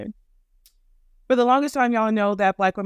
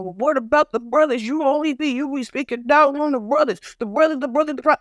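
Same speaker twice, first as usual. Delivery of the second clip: mocking whiny critic voice, pitched up; 4 semitones higher than usual. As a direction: parody a complaining critic, lightly put on. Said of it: "And I know what's coming".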